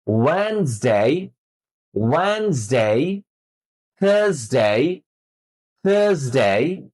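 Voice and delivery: drawn-out weekdays, Wednesday as "wenz-day", Saturday as "sa-tuh-day"; model pronunciation. Shown as a demonstration. Teacher drills মানডে to Saturday for exact imitation, careful pronunciation. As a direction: stretch মানডে to Saturday, gliding through each "day". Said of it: "হুবহু উচ্চারণটা করতে হবে".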